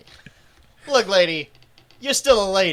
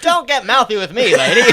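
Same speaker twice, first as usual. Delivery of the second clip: mock operator; 4 semitones higher, 6 dB louder than usual. Operator retort, mock tough.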